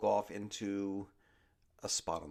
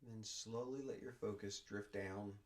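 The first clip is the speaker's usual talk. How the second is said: guided meditation; 2 semitones lower, 7 dB quieter than usual. Acted. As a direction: ease them down into stillness, word by word. Leads a body scan meditation.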